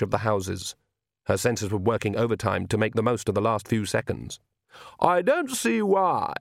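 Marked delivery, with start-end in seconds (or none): 5.0-6.3 slow, high, sawing complaint; cutting voice. A narrator's reading. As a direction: draw it out high so it cuts through mist. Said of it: "voice cut through the mist like a saw".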